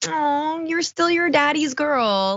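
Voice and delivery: high, slow, cooing teasing voice; mock cooing at a daddy's girl; mock baby talk. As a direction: coo at her like a proud parent. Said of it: "it's just kind of adorable 'cause it's like".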